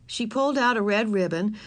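plain reading voice

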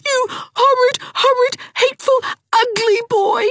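put-on high, tearful girl's wail; high crying threat; weeping. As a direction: wail it through tears. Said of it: "wept Ivy".

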